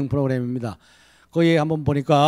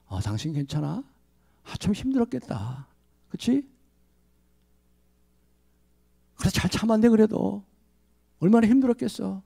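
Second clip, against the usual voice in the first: much softer, caring consolation; tender comfort; 5 semitones higher, 5 dB quieter than usual. Shown as a demonstration. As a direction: console your wife gently. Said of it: "이렇게 얘기를 해줘야 되는 거죠".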